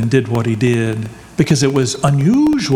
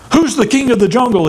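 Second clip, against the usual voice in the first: higher, fast mock snarl; 8 semitones higher, faster than usual. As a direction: growl it at the zebra like a bully. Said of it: "he snarled".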